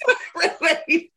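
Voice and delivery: high, laughing through the words; laughing relief. Real, not acted. To the host about a draining client, laughing relief.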